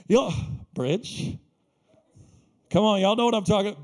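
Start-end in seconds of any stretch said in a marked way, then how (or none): 0.1-1.4 hesitant one-word question, pitched down; skeptical query. Acted. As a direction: echo the name, unimpressed. Invitee doubts the church.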